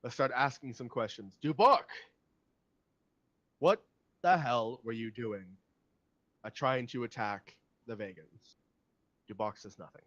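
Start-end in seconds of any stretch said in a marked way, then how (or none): 0.0-8.6 louder, interrogating leader; other leader. Acted. Leader questioning the captive, forceful and louder. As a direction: take charge, punching the prisoner's name. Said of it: "one of the other leaders goes".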